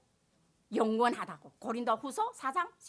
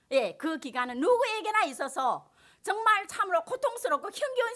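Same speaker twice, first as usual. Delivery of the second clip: rising, louder sermon delivery; preaching; 4 semitones higher, 6 dB louder than usual. Acted. Pastor preaching to the congregation, at full force.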